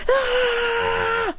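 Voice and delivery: high, long drawn-out battle yell; attack cry. Acted. Son blasting a fire beam, high drawn-out attack cry. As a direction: charge up and hold the cry.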